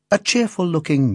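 ordinary delivery

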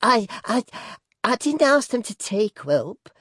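high, hesitant stammer; nervous, halting protest in a high voice; stammering wife. A narrator's reading. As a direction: stammer it out timidly to a domineering husband. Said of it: "stammered his wife".

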